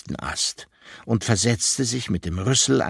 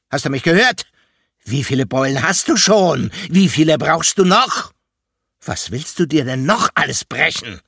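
higher, louder scolding questions; angry scolding; 4 semitones higher, 8 dB louder than usual. A narrator's reading. Elephant scolding the climber about his bumps, angry. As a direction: scold him like a furious guardian. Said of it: "Jetzt werde ich wirklich böse".

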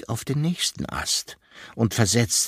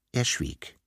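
ordinary delivery

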